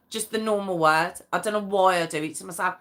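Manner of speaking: ordinary talk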